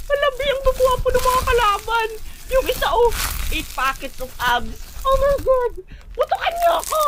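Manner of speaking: very high, giddy fan squealing; swooning squeal. Acted. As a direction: gush over the handsome enemy, high-pitched. Swooning over handsome enemy soldiers. (kinikilig na tumitili)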